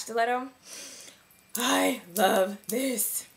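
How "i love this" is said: very slow, voice breaking oddly; the voice slips and cracks; voice cracking. Real, not acted. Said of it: "I don't know why my voice had to get".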